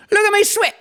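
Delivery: very high, loud squeaky little joey with Australian twang; squeaky Roo. A narrator's reading. Roo squeaks proudly to everyone from the pool, very high. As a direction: squeak it out like an excited little kangaroo.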